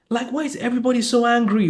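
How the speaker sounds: ordinary delivery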